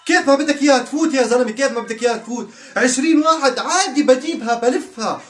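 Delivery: high, loud, thrilled commentary; loud, laughing, hyped-up reaction; excited yelling. Real, not acted. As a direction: cheer every attacker on. Cheering Madrid attack live.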